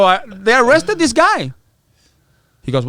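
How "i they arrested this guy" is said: high, loud, drawn-out news break; excited announcement. Retold or imitated. Client breaking news to his attorney, excited. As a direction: blurt the big news, stretching it out.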